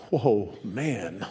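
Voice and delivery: drawn in wonder, understated; amazed Adam. Acted. Adam wakes up and sees her, quietly amazed. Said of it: "imagine how amazed Adam is".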